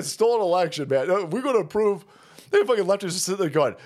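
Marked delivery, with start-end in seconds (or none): none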